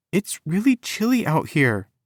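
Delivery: high, whimpering complaint. A narrator's reading. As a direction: whimper for pity. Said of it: "she whimpered".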